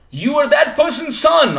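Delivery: high, slow, drawn-out realisation; amazed recognition. Acted. Acts stranger recognising celebrity's lost son.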